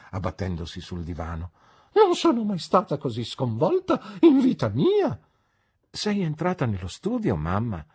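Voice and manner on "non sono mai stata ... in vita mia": high, loud and flustered with a laugh; fussy old lady. A narrator's reading. Mother collapses on the sofa, loudly flustered. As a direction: overwrought dowager declaring her shock. Mrs McLean voiced.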